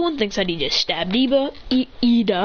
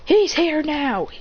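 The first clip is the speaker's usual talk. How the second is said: higher, drawn-out panicked cry; alarmed outburst; 8 semitones higher, much slower than usual. Acted. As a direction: alarm as the rival appears.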